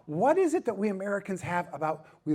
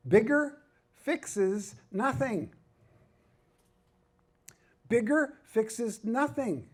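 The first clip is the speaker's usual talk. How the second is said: very slow, raised, word by word; emphatic key point; 7 semitones higher, much slower than usual. Real, not acted. Preacher telling pastors what they need to figure out, slow and emphatic. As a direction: drive it home with a space after each word.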